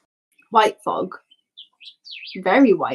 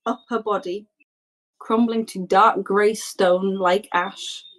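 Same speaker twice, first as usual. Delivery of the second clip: normal talking voice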